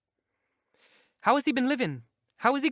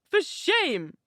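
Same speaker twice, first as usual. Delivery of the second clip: much higher, sharper second rebuke; angrier repeat; 9 semitones higher than usual. A narrator's reading. Isabella rebuking Catherine again, angrier and higher. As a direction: let the anger rise on the repeat. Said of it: "she repeated angrily".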